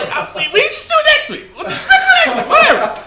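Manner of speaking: high laughing squeaky mock voice; mock lazy developer. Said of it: "they were like".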